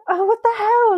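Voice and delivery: high-pitched exasperated outburst; mock exasperation. Film viewer retelling their reaction to a crude line, exasperated. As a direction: let the voice leap up in disbelief.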